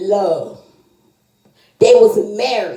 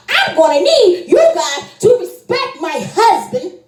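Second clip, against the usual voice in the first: defiant plea; 9 semitones higher than usual. Retold or imitated. Daughter defending husband to mother.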